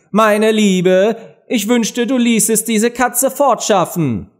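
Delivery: höher und lauter gerufen; rufender König. A narrator's reading. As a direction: ruf es der Königin hinüber. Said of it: "rief der Königin zu". (der König ruft der Königin zu)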